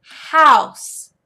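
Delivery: loud, emphatic vocabulary word; drawn-out, falling call of a word for a pupil; teacher modeling. Shown as a demonstration. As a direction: say it big and clear for a young learner. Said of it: "you'll say".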